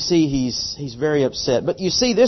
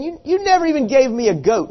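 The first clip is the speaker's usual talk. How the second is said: higher, quick whine about the goat; indignant, fast complaint; 10 semitones higher, faster than usual. Retold or imitated. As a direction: rattle off the grievance. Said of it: "he's saying".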